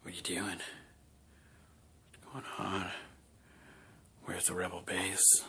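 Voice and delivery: creepy stalker. Retold or imitated. Kylo Ren staring at a prisoner, low creepy murmur. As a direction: breathe it like a stalker staring you down. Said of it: "standing over there staring at you".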